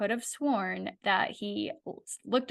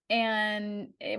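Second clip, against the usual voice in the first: ordinary talk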